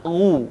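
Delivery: "ru" as throaty ghu with pouted lips; "ru" with back-of-throat gh and pure u; full rounded u. Shown as a demonstration. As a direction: push the lips forward for a complete u in "ru". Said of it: "keluar vokal u dengan sempurna".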